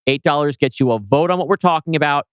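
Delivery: normal talking voice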